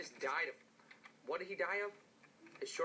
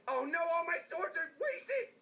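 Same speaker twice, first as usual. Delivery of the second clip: mock wail; 11 semitones higher than usual. Mock despair over lost swords.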